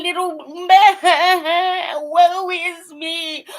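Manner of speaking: drawn-out sing-song fake whimper; mock sniveling. Mocking players as sniveling, slow fake whine. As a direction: stretch it out like a crybaby. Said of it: "sound like sniveling".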